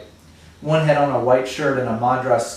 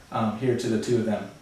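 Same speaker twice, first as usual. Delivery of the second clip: ordinary delivery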